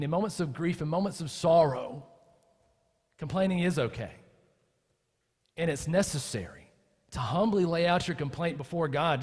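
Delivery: normal talking voice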